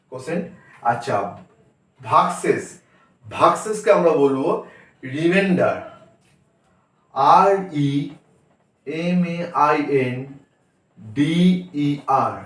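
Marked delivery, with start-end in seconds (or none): none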